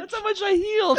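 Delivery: high, loud laughing squeal; real helpless laughter in the voice; laughing exclamation. Real, not acted. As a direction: burst out laughing through the words. Laughing at damage just healed.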